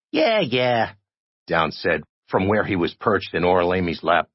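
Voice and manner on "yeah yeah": higher character voice; dismissive. A narrator's reading. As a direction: brush it off, pitched up. Small character answers dismissively.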